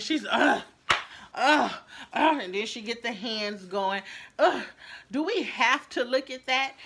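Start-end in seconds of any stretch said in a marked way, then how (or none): 0.3-2.4 loud exasperated ugh, ugh, ugh; loud frustrated grunts. Retold or imitated. Rude customer in line groaning loudly at the delay. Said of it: "she gets frustrated". 4.4-6.7 disgusted. Retold or imitated. Rude woman mocked in line.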